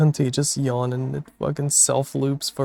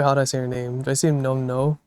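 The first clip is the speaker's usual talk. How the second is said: normal talking voice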